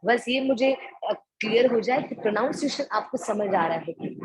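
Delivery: ordinary talk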